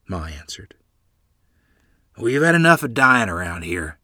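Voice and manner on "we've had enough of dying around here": mildly gruff, louder. A narrator's reading. Grandfather cuts off talk of dying, gruffly. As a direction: put a touch of gruffness in it. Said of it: "Grandfather said gruffly".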